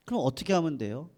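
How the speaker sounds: ordinary talk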